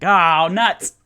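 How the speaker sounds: loud, high comic dismay; drawn-out mock-dismayed outburst; mock frustration. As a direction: cry it out in playful exasperation. Retold frustration at own fillers.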